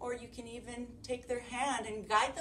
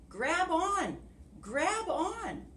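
bear calling; 10 semitones higher, slower than usual. Acted. Sun Bear calls to the trapped animals, higher call. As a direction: urge the animals to grab the branch.

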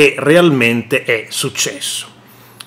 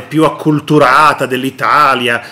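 usual speaking voice

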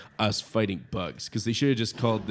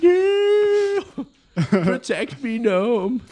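high, loud hammed-up panic; mock panic; 13 semitones higher, 6 dB louder, much slower than usual. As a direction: plead in mock terror. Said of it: "Just like".

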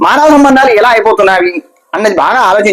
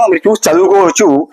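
ordinary talk

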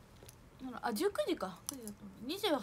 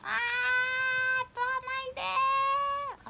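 high, long-drawn wail of protest; 10 semitones higher, 3 dB louder, much slower than usual. Real, not acted. Player begging not to lose an item, drawn-out protest. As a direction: stretch out a high plea, slowly. Wails as game item is taken.

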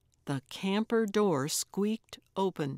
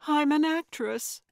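put-on protesting young woman; 8 semitones higher than usual. A narrator's reading. Actress insisting to the old woman, higher and pleading. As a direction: insist earnestly, a little alarmed.

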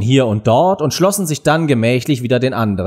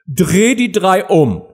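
high, slow irritated command; annoyed queen; 7 semitones higher, 3 dB louder, slower than usual. A narrator's reading. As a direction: order it crossly, drawing the words out. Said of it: "wandte sich ärgerlich von ihm ab". (ärgerlicher Befehl)

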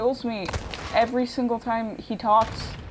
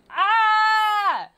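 short high yell of frustration; frustrated scream; 9 semitones higher, 6 dB louder than usual. Real, not acted. Player yelling at the game, a frustrated scream. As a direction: gamer screaming at the tank.

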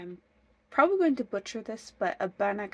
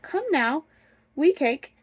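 mild, higher-pitched call; crying out; 6 semitones higher, 7 dB louder than usual. A narrator's reading. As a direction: call to the little cake, pitched up. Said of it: "she cried out".

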